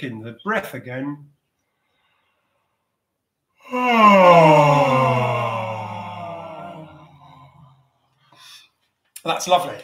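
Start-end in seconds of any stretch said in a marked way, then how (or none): 3.7-6.8 a long, loud voiced sigh that slowly falls away. Shown as a demonstration. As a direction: let the breath out on one loud falling sound. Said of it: "release that sound now when we've taken that breath in on any sound you like".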